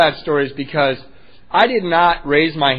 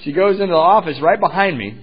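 normal talking voice

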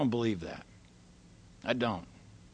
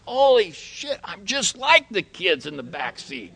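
amazed realization; 7 semitones higher, 4 dB louder than usual. Retold or imitated. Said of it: "And I'm like".

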